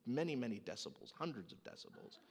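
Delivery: ordinary talk, nothing marked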